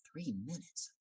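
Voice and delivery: hushed, incredulous question; acted quiet alarm on "Three minutes"; disbelieving thought. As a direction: question "Three minutes" softly to yourself, rising at the end. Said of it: "And I thought".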